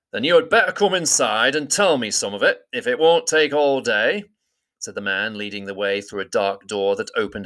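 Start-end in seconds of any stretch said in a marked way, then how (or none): 0.1-4.2 higher, hearty big man's voice; grudging welcome from a giant host; Beorn inviting grudgingly. A narrator's reading. Beorn inviting them inside, hearty but grudging.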